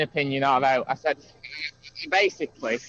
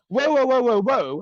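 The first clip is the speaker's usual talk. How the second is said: higher, louder whoas cutting him off; retold alarmed protest; 7 semitones higher, 5 dB louder than usual. To his friend in a heated argument, a sharp startled protest. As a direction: stop him short, alarmed. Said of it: "And I'm going".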